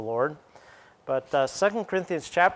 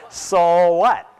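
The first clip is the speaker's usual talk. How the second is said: a louder, rising "So what?"; 2 semitones higher, 8 dB louder than usual. Shown as a demonstration. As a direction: toss off "So what?" with a challenge. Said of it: "shorten it up with the word what".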